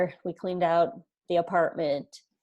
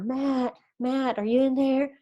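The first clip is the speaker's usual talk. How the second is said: higher-pitched mock call through a door; imitated calling out; 7 semitones higher than usual. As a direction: call for someone, without real volume.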